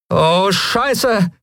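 high-pitched, drawn-out dismay; oh-no swearing, pitched well up; alarmed oath. Acted. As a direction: curse as the attackers come at you. Lien echoes oath as attackers charge.

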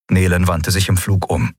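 plain reading voice

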